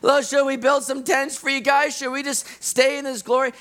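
high, loud, eager babbling; rambling Peter. Retold or imitated. Peter rambling about tents on the mountain, high loud eager. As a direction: tumble out the eager offers. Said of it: "he starts rambling".

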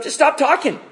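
sharp, high mock rebuke; rude brush-off. Bildad shutting Job down, rude and high. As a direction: cut him off with a dismissive snap. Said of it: "And he just comes rudely".

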